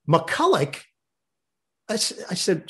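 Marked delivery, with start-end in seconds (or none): 0.1-0.8 McCulloch repeated as muh-CULL-ick; McCulloch with final k. Retold or imitated. Announcer echoing the father's correction, McCulloch pronounced with final k. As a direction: echo McCulloch with its closing k.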